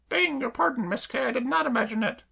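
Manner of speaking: high, chirpy cockney maid voice; perky maid reassuring her mistress; Claire the maid. Acted. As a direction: pitch her up and pert. Claire firm.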